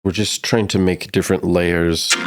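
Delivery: ordinary talk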